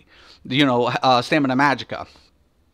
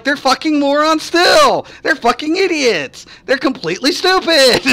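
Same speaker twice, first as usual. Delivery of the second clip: much higher, louder, half-laughing disbelief; exasperated rant; 11 semitones higher, 3 dB louder than usual. Retold or imitated. Streamer ranting about meta players, high exasperated squeal. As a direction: squeal it out in mock despair. His own quoted rant.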